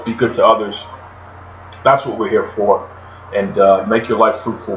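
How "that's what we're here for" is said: ordinary delivery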